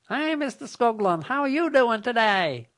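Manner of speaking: cheery automated caller voice; robot telemarketer. Pretend. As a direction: chirp a canned sales greeting. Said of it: "a robot reaches a live person".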